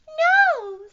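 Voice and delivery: very high, loud squeaky bird; piercing little bird voice; Beebe bird. A narrator's reading. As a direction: squeal it like a tiny defiant bird. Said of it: "said the Beebe Bird".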